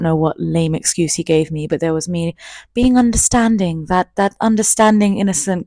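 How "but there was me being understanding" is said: ordinary delivery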